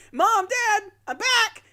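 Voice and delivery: high-pitched, loud kid impression; Butters. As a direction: call out in a very high child's voice. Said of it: "Butters is just acting normal. He's like".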